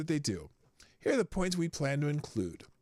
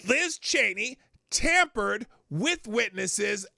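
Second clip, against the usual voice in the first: louder and higher, slow and hammering; raised indignant declaration; worked-up emphatic rant; 7 semitones higher, 6 dB louder than usual. Real, not acted. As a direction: hammer home each point, indignant. Host repeating claim, worked up.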